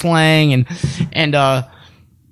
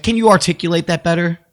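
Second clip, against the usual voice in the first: ordinary talk, nothing marked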